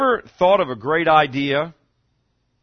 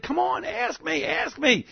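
much higher, pleading ask me; coaxing God voice; 11 semitones higher, 3 dB quieter than usual. Acted. Voicing God inviting people to ask, much higher and pleading. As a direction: coax them like a father calling. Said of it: "well, God said".

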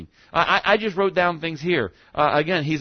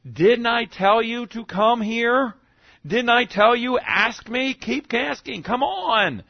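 higher, slow, coaxing reproach; urging Father; 6 semitones higher, slower than usual. Acted. Voicing God urging his children to come, coaxing and higher. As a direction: gently scold like a father calling his child. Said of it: "it almost could be that He said".

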